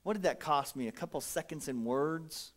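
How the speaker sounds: low, soft reassuring words; kind encouragement. Retold or imitated. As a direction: quietly build up the tired waitress. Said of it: "I'm like".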